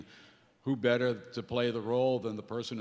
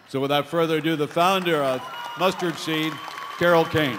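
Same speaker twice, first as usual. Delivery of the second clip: louder, building announcer call; grand introduction; 5 semitones higher, 8 dB louder than usual. Real, not acted. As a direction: bring her up on stage, name ringing out. Introducing the speaker to the crowd.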